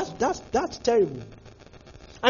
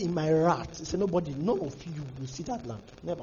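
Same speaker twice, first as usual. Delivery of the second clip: quiet stern God; 8 semitones lower, 10 dB quieter than usual. Retold or imitated. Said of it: "He was angry".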